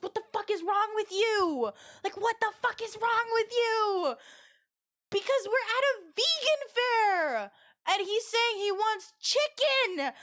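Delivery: high, drawn-out squawk of disbelief; mock outrage. Storyteller replaying how she scolded her friend at a vegan fair, mock outrage. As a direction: scold him in exaggerated, high-pitched exasperation. Retold scolding of tactless coworker.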